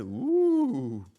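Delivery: loud, high, drawn-out "ooh"; attention-grabbing whoop. Pretend. Vlogger holding viewers' attention without editing, loud whoop. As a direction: whoop high and long like a homemade sound effect. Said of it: "faut bien le faire autrement".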